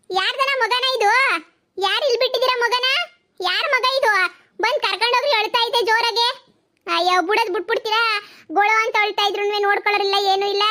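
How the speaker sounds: high, loud call across the street; hollering to the neighbours about a found baby. Acted. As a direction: shout for the owners to come. Calling out to neighbours.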